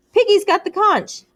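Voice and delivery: high loud insistence; insistent shout. A narrator's reading. Ralph insisting Piggy holds the conch, loud. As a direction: hammer the point home.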